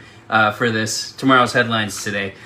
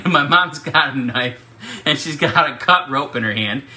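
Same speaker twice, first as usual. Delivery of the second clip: ordinary talk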